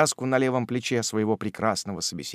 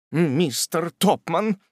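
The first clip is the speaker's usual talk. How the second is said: higher, slow flustered cry; blushing exclamation; 7 semitones higher, much slower than usual. A narrator's reading. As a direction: gasp his name, embarrassed. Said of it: "воскликнула Рэчел, краснея как пион".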